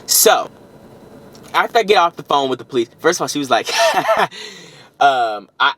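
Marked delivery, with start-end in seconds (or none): 3.7-4.5 short high laugh. Real, not acted. Laughs recalling the dispatcher.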